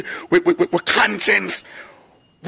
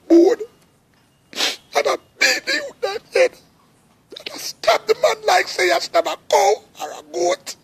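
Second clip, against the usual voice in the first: high voice breaking with sobs; real crying. Real, not acted. Mourning the killed youth to his brethren, sobbing. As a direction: weep through the words, barely holding together. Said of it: "me start cry".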